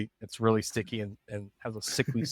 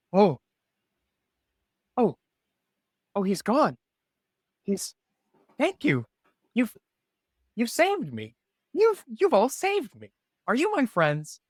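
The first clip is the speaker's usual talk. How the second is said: high, small bird voice calling for help; tiny bird voice; 8 semitones higher, 3 dB louder than usual. Acted. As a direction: squeak it out like a frightened little bird. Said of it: "It says".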